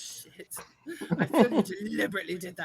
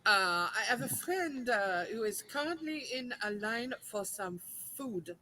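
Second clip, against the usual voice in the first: put-on French accent. Customer asking for two tickets for friends, French accent. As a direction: explain patiently in a French accent. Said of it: "do it in your French accent".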